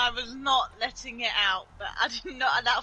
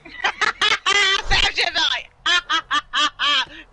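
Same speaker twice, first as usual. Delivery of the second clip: big loud belly laugh; loud laughter at a friend's mishap; loud laughing fit; 5 semitones higher, 11 dB louder than usual. Real, not acted. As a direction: burst out laughing loudly.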